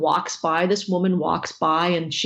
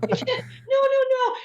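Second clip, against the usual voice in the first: high, squeaky panicked reassurance, played for laughs; flustered pleading; 12 semitones higher than usual. Actor begging a bawling fan to stop, comic flustered pleading.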